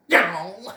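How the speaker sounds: short, loud cry; sudden yelp. Acted.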